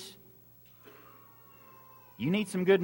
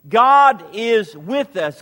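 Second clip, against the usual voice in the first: proclaiming; 8 dB louder, slower than usual. Real, not acted. Preacher proclaiming climax.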